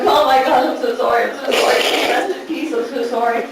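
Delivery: loud, hammed-up apologizing; repeated frantic apology. Apologizing to the dead in the urn, frantic. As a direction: keep apologizing in mock alarm. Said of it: "I just kept apologizing".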